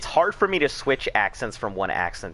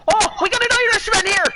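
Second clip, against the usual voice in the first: put-on much higher, loud excited shout in an Irish accent; 8 dB louder than usual. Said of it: "Why'd you get so excited".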